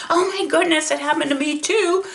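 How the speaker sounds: high-pitched imitation of an amazed friend; amazed exclamation. Friend's amazed reply, acted.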